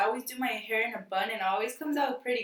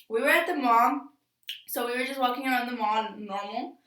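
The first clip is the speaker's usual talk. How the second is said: usual speaking voice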